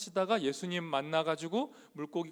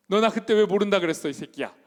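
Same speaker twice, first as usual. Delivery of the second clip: imagined angry rebuke; 4 semitones higher, 9 dB louder, faster than usual. Pretend. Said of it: "이렇게 하시라고요".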